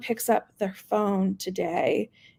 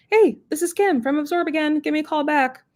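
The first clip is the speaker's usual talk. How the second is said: higher-pitched, nicer phone voice; 7 semitones higher than usual. Shown as a demonstration. Rep leaving a voicemail for a prospect, higher sweet phone voice. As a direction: sound like they're expecting your call. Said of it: "a phone voice that's intentionally a little bit higher pitched to sound nicer".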